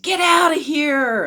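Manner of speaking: high, loud, drawn-out jeer; hostile, slow holler; heckler's shout. A narrator's reading. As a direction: shout to drive someone off. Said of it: "another heckler shouted".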